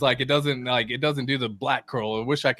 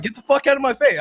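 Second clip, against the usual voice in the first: acted angry comeback; 11 semitones higher, 4 dB louder than usual. Acting out angry comeback to instructor.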